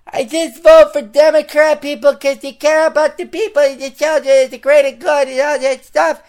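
high, loud mocking voice; mocking voter quote. Mocking leftist women voters in a high, loud put-on voice. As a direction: ham up a clueless voter. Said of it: "dumb leftist women have".

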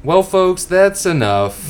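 high, loud, slow showman sign-off; announcer sign-off. Pretend. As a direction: close out the show like an old host. Sign-off to listeners.

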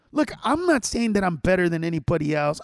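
ordinary talk

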